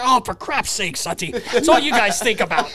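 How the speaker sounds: high, exasperated Roger voice breaking into laughter; high, laughing, exasperated Roger voice; imitating Roger. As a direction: huff it like a flustered old coach. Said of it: "it's just so funny to hear Roger say".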